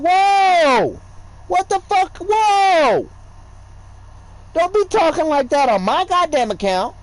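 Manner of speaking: high, loud startled shouting; alarmed yell. Retold or imitated. Retelling his reaction to her cursing, loud startled yell. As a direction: bark out the shock at what she said. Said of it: "I'm like".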